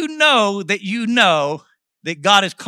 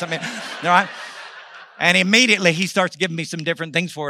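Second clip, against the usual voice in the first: ordinary talk, nothing marked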